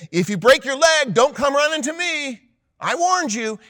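high, loud mock scolding; mock parental warning. Parent warning the kid horsing around, mock scolding. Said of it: "It's a humorous way of saying it".

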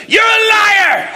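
high, drawn-out yell imitating an accuser; mock accusing shout. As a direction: slowly holler a hammed-up accusation. Elder accuses the young man.

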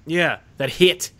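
slow New Zealand accent with hat said as hit; Kiwi accent. Shown as a demonstration. Answering with the Kiwi version, slow New Zealand accent. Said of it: "Hit is Kiwi".

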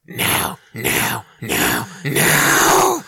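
rising panicked no; screaming no. Acted. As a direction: scream it as the demon is cast out. Demon resisting exorcism.

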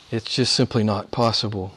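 ordinary delivery

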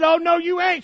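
high, loud stern refusal; scolding mama. Retold or imitated. Mama refusing muddy son entry, shrill scold. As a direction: stop him cold at the door. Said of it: "she said".

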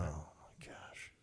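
breathless whispered disbelief. Real, not acted. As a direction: drop to a stunned whisper. Stunned reaction to Super Bowl memory.

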